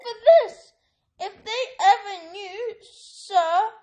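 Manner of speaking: high, anxious elf voice; a loyal servant confessing; house elf. A narrator's reading. Elf explains his family to Harry, high. Dobby voice.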